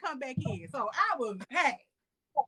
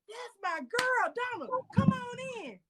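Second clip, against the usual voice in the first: high-pitched, drawn-out mock greeting; big retold welcome, pitched way up; imitated hearty welcome; 10 semitones higher, slower than usual. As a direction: mimic him welcoming in a favourite guest. Acting out his later warm welcome.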